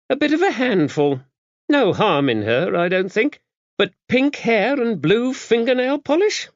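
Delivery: high, gruff abbess clucking over pink hair; scandalised old nun, half amused; disapproving abbess. A narrator's reading. Dame Tabitha gossiping to Max about the daughter, disapproving.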